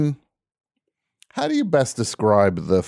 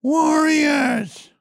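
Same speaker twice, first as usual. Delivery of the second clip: put-on kid yelling Warriors. Callback to kid's yelling.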